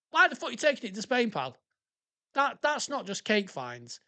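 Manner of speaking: high, fast incredulous challenge; exasperated disbelief. Pretend. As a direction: demand an answer from a daft colleague. Incredulous challenge to absent officer.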